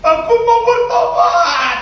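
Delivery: high, loud, slow shout; husband's cry. Acted. Risen husband crying out to repent, high loud shout. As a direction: shout it out like a man back from death. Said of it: "Suaminya bangkit ke duduk".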